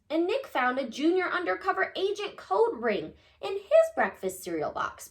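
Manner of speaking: ordinary delivery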